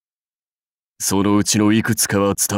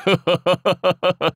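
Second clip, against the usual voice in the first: short high rolling chuckle; staccato warlord laugh; hearty laugh; 8 semitones higher than usual. Acted. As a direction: laugh briskly, like an amused lord. Amused encouragement of follower.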